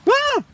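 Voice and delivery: very high startled shriek; fright scream. Acted. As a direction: shriek at a spider. Spider in the box.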